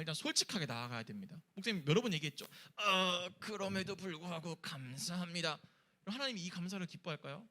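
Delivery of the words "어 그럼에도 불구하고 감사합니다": slow, drawn-out mock-pious gratitude; forced thanks. Pastor mocking an insincere prayer to God, forced thanks. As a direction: drag out a thanks you don't feel.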